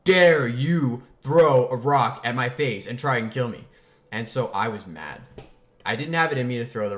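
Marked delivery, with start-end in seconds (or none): 0.1-3.7 loud, slow furious yell; how dare you, loud and slow; enraged. Retold or imitated. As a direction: roar it with outrage. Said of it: "like, enraged".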